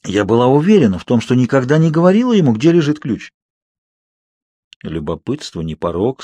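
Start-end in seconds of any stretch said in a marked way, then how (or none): 4.8-6.2 a lower, softer sheepish admission; mildly abashed, owning up; embarrassed. A narrator's reading. Edward caught knowing the key spot, sheepish. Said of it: "сконфуженно произнёс Эдвард".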